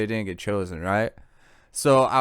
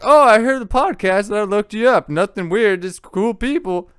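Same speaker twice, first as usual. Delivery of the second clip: higher, bright, eager fan voice; high friendly voice; 9 semitones higher, 7 dB louder than usual. Retold or imitated. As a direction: play a keen stranger online. Voicing strangers online.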